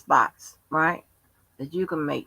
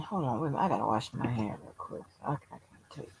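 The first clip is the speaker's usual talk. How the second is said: quiet aside; 13 dB quieter, much faster than usual. Real, not acted. Stepping away to wash hand.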